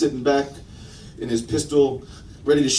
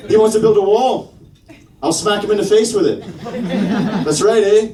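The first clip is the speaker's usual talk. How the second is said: put-on higher-pitched, cocky Canadian accent; 4 semitones higher than usual. Canada's president threatening to beat up Trump, Canadian accent. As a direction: square up for a fight in a Canadian accent. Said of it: "First time I ever tried a Canadian accent".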